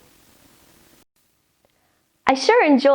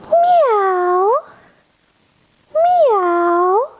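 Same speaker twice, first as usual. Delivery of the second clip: high, long swooping meows; cat meow; 7 semitones higher, 3 dB louder than usual. Pretend. Reader in cat pose with kids, high drawn-out meows. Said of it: "and say".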